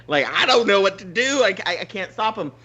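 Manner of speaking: ordinary delivery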